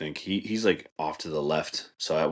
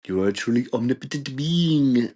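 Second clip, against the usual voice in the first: airy, higher mystical channeler voice; mimicking her channeler voice; 6 semitones higher, 4 dB louder than usual. Vlogger mocking a trance channeler, airy mystic voice. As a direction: float each word like a spirit medium. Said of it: "the way she talks is like".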